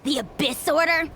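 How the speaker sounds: ordinary talk, nothing marked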